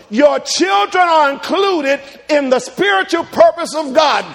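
loud, high sermon shout; preaching shout. Acted. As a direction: hammer the refrain home.

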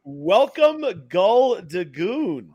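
grand entrance announcement. Acted. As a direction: stretch the name like an MC. Introducing the arriving guest.

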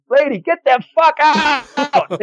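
very high, drawn-out mock yell; exasperated yell. Club cleaner to customer who won't leave, hammed-up exasperated yell. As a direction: imitate a fed-up cleaner hollering at a customer.

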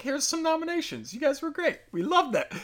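very high, soft, singsong awarder voice; mock gushing praise. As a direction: coo it sarcastically, way up high.

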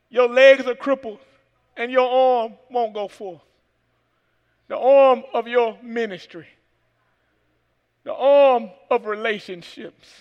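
slow, building preaching cadence. Acted. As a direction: preach each line with weight.

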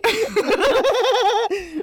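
high shrieking burst of laughter; loud laughter. Real, not acted. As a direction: cackle out loud.

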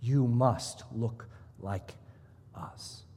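slow, quiet mock legalist, fading to a whisper on "us". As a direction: drag it out like a smug gatekeeper, hush the last word. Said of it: "We say".

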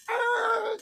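high, sustained squeal of tires; imitated tire screech. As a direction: mimic a car peeling away with one held high note. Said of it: "She skirted off".

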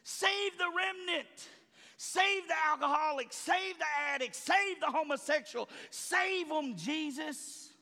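preacher voicing his own fervent prayer for the lost; hollering prayer. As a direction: cry out to God on your knees. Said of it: "I'm on my knees hollering".

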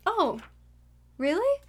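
skeptical. Retold or imitated. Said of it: "and she was like".

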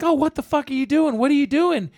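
high-pitched mock disbelief; retold shocked outrage. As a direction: imitate a stunned, indignant boyfriend. Acting out man catching girlfriend cheating.

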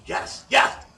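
loud, high re-enacted yes; excited insistence. Telling companion someone just said hello, excited insistence. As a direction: insist loudly, pitch jumping up. Acting out startled reply to companion.

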